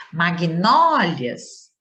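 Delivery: "Magnólias" very slow, with NÓ drawn out; stress on NÓ. Shown as a demonstration. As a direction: stretch the NÓ of "Magnólias" and let the ending fall. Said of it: "já classifiquei como paroxítona". ("Magnólias" com o NÓ forte e bem alongado)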